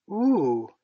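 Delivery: high, loud gasp of delight; rapturous. A narrator's reading. Wendy hears she will learn to fly, rapturous. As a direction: exclaim in rapture. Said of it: "she exclaimed rapturously".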